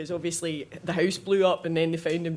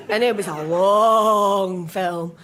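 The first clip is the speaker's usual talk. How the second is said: slow and louder, leaning on every word; deadpan, weighted complaint; weary emphasis; 7 dB louder, much slower than usual. Real, not acted. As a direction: drag it out to feel the film's length. Complaining about a film.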